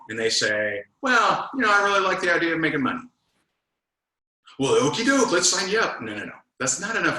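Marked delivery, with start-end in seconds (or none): 1.0-3.0 naive prospect. Retold or imitated. Mimics a shallow prospect answer. 4.6-6.0 mock eager closer. Mocks a lazy closer.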